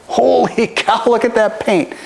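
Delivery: high, amazed exclamation. Retold or imitated. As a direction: gasp it out in awe with a laugh. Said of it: "so many people came in and was like".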